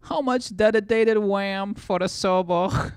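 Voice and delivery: high, slow, loud goofy voice; silly voice. Pretend. Streamer reading a chat message, silly high voice.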